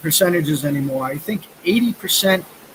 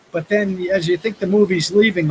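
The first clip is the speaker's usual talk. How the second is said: ordinary talk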